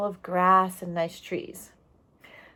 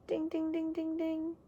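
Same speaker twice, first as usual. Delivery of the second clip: high, soft sung-out bell imitation; bell chime; 10 semitones higher, 10 dB quieter than usual. Teacher signalling a key word to the class with a bell chime.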